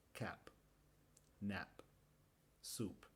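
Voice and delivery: "Cap", "nap", "soup" con la p final apenas soltada; p final casi muda. Shown as a demonstration. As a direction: deja la p final casi sin sonido. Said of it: "no hacemos el sonido o lo hacemos muy poco". (p final muy suave, sin explosión)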